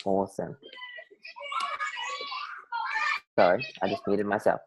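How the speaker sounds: whispered aside while muted; breathy unvoiced whisper. Real, not acted.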